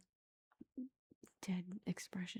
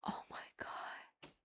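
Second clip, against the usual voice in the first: imitating a gasped, whispered oh-my-God; soft, slow breathy shock, as if retelling her horror; gasp, then breathe the words out slowly; horrified gasp; 6 dB quieter, slower than usual. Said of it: "gasp and be like".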